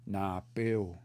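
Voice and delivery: "na, peu" with the final W given its own sound; W sounded separately. Shown as a demonstration. Teacher showing learners the W sound, carefully separated. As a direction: split "na, peu", rounding off the last syllable. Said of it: "W makes its own sound".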